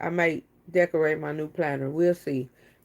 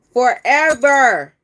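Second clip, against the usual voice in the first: slow, loud, higher title drawn out; dramatic title drop; 5 semitones higher, 9 dB louder, much slower than usual. Real, not acted. Naming the movie to viewers, dramatic and drawn out. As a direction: announce it like a movie trailer.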